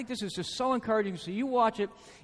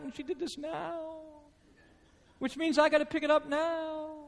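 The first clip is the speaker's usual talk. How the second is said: softer, self-pitying grumble; mock pity party; 6 semitones higher, 5 dB quieter, slower than usual. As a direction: whine to yourself about the chores. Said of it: "I had a little pity party".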